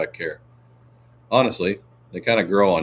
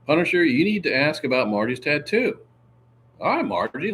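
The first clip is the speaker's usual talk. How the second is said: ordinary talk, nothing marked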